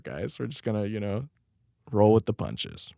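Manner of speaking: ordinary delivery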